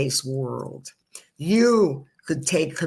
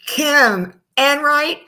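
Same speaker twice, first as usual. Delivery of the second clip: high, loud, slow host's call into the studio; calling her in; 10 semitones higher, 8 dB louder, much slower than usual. Real, not acted. Host calling the guest into the studio, drawn-out loud call. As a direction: announce her name like bringing on a guest. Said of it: "and I'm gonna say".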